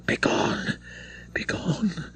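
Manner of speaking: breathless whispered begone; a terrified girl whispers at the priest; low faltering whisper. A narrator's reading. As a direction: hiss it out in horror, almost no voice. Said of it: "in a voice low and faltering".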